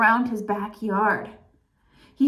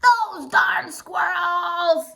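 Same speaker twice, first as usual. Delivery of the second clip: put-on slow drawn-out grumpy old man shout; old man yell; 5 semitones higher, much slower than usual. Old man yelling at the squirrels, slow and drawn out. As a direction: stretch it out like a cranky old man. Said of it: "yelled Old Man Fuquire".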